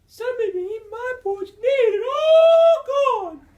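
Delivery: very high, loud squeaky wail; Baby Bear. A narrator's reading. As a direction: squeal it like an upset little bear cub. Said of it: "And Baby Sir Bear says".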